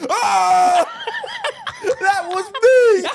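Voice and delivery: high, loud, cracking up through the words; laughing confession. Retold or imitated. Pete admitting the fart in the shopping centre, laughing shout. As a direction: blurt it out while laughing. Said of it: "Pete was like".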